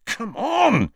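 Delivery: high, insistent urging; exasperated, high-pitched prod; impatient urging. A narrator's reading. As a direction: push a reluctant friend along. Ron urging hesitant friend on.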